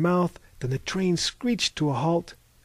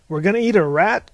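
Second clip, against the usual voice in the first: loud, higher-pitched incredulous question; alarmed cry; 5 semitones higher, 9 dB louder than usual. A narrator's reading. American misunderstands the Spanish lunch call, alarmed cry. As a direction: cry out in disbelief. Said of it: "cried the American".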